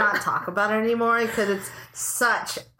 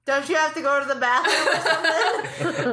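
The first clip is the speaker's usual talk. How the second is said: parent voice; 6 semitones higher than usual. Retold or imitated. Parent deflecting.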